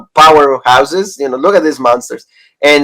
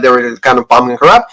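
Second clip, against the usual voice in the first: ordinary delivery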